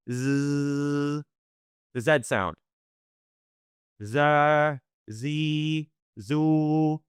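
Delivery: z buzzed long and steady; held z sound. Shown as a demonstration. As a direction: hold the z as a long buzz. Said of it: "pay attention to".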